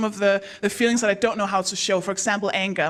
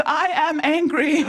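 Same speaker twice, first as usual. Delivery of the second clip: raised, hammed-up announcement of anger; higher, emphatic mock anger; blurted declaration; 5 semitones higher than usual. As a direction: blurt it out stiffly at a friend. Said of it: "so I just went".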